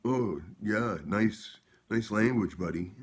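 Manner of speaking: high, loud mock sarcasm; hammed-up sarcastic retort; sarcastic jab. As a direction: sneer it back at him. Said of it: "and he was like".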